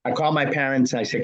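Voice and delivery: ordinary talk, nothing marked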